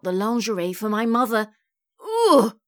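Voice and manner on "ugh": loud, high "ugh" of disgust; revolted groan. A narrator's reading. Mum reacts to the idea of Eric, revolted. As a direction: shudder out a loud noise of revulsion. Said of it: "I gave a shudder of revulsion".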